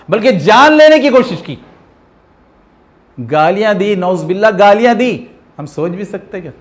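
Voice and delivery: loud, high rising sermon cry; impassioned preaching. Acted. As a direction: preach with full force and grief. (پورے جوش سے وعظ)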